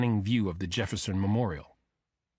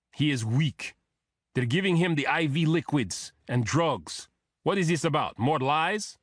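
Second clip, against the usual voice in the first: put-on higher, indignant thick Russian accent; 5 semitones higher than usual. A narrator's reading. Bodyguard challenging agents about his sick boss, thick Russian accent. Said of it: "in the same thick accent".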